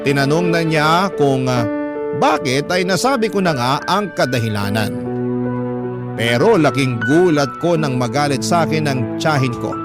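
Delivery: ordinary talk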